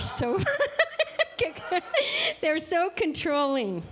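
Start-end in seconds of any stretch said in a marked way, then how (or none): 0.4-2.3 high bright laugh; amused laugh at own joke. Real, not acted. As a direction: burst out laughing.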